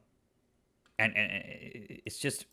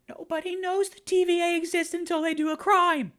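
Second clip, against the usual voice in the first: much higher and louder, peak of the rant; outraged outburst; 5 dB louder than usual. Real, not acted. Reviewer exploding about the unfair trial, much higher and louder. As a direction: blow up in disbelief.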